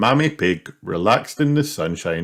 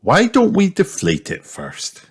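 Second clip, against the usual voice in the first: high, lilting suggestion; raised-pitch motherly voice; mother figure; 11 semitones higher than usual. A narrator's reading. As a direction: suggest it in a light mummy voice. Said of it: "said Mummy Pig".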